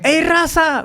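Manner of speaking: high mock call across the road. Migrante saludando a otro en el camino: llamado agudo. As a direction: hail a fellow migrant from afar. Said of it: "me gritó uno".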